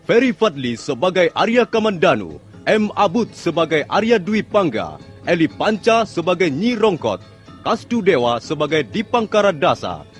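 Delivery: ordinary talk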